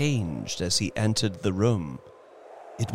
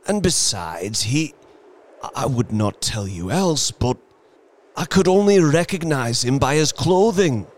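shaking voice; 7 semitones higher, 4 dB louder than usual. Acted. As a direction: voice shaking with dread. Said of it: "and his voice shook".

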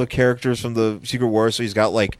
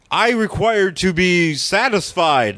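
slow, higher robotic demand; hammed-up stiff alien asking to be fed; mock alien demand; 6 semitones higher, much slower than usual. Beyonder at the hot dog cart, slow stiff demand.

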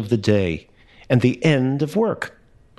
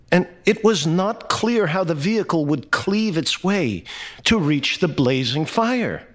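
ordinary delivery, nothing marked

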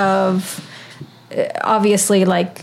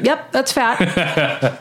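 slow, dull deadpan; deadpan Joey; 3 semitones higher, much slower than usual. Retold or imitated. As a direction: drag it out flatly. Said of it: "Joey's delivery".

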